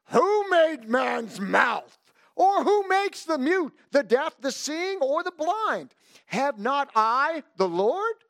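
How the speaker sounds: slow, commanding divine shout; booming voice of God. Acted. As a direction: proclaim it like God rebuking Moses. Voicing God answering Moses.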